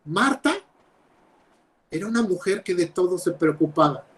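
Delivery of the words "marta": ordinary talk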